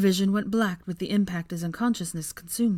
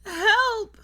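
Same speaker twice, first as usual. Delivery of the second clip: high, loud desperate cry; panicked shriek; screaming for help; 6 dB louder than usual. A narrator's reading. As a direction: scream it upward for rescue. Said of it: "screamed as loud as she could".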